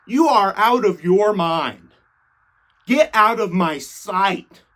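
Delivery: indignant tirade with swooping pitch; hammed-up righteous fury; finally snapping. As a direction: rant in mock outrage, slowing for the final dismissal. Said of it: "This is when Jesus finally snaps".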